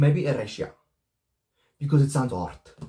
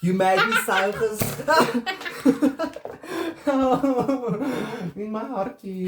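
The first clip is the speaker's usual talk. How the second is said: talking through big laughter; high, helpless laugh breaking up the words; laughing hard; 8 semitones higher than usual. Real, not acted. Sister to sister, can barely talk, laughing hard.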